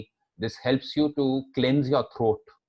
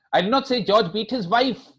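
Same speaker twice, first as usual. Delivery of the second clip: wife stressed with a rise; 9 semitones higher, 4 dB louder than usual. Shown as a demonstration. Teacher points to another victim, stress on wife. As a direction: lift and stress the word wife. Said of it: "He might have beaten his daughter".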